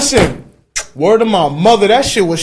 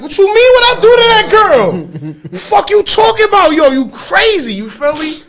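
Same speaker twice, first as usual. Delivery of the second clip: indignant retort; 9 semitones higher than usual. Retold or imitated. Retort to accusing aunt.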